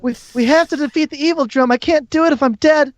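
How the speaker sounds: high, strained character voice; pleading wounded figure. Acted. Game master voices a figure.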